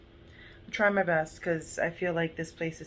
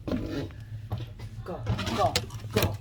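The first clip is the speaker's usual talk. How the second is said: firm, louder commands to a dog; shooing the dog; 3 dB louder, slower than usual. Real, not acted. Ordering the dog Misha upstairs, firm and louder. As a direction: shoo the dog up the stairs.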